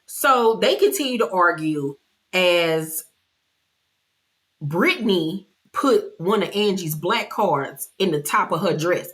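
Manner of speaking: ordinary delivery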